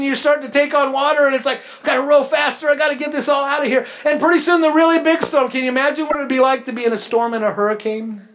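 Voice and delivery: full-force pulpit voice; preaching at full force. Acted. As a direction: drive the storm image home to the room. Preacher building the storm image.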